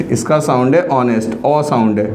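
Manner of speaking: ordinary talk, nothing marked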